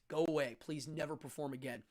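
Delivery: normal talking voice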